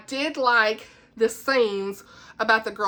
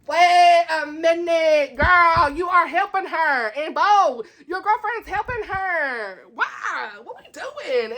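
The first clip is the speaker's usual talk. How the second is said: exasperated disbelief; 6 semitones higher, slower than usual. Retold or imitated. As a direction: scold the characters in disbelief. Incredulous rant at the characters.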